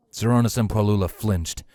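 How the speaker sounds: ordinary delivery, nothing marked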